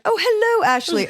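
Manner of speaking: high, loud parroted British greeting; mock British accent. American echoing a British guest's greeting, mock British accent. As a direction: parrot the posh British accent back, hammed-up. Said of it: "do your British accent back".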